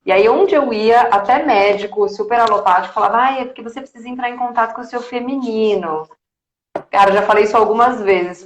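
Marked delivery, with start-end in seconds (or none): 3.2-6.1 higher, softer imitation of a doctor; lightly put-on preachy doctor voice; mocked doctor advice. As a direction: mimic well-meaning advice, softly. Quoting doctors' advice mockingly.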